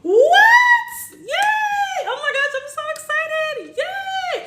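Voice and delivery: real shrieks of joy, very high; thrilled "What?" then squealed "Yay!"; excited squeal. Real, not acted. Host celebrating a viewer's shrinking fibroid, shrieking with joy. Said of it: "this is me".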